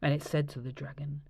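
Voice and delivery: ordinary delivery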